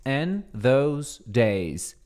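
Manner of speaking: "In those days" said very slowly, word by word; very slow model phrase. Shown as a demonstration. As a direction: pronuncia lentissima di "In those days". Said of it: "Literalmente quer dizer".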